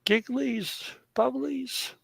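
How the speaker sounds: put-on calling the pet in soft baby talk; cutesy baby talk. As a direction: coo the pet's names sweetly.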